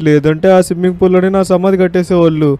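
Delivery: high-pitched exasperated protest; indignant retort. Pretend. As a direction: snap back at being told to wait. Retorts to being told be patient. (కోపంగా, పై స్థాయిలో ఎదురు ప్రశ్న)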